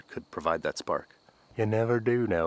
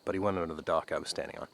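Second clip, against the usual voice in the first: ordinary talk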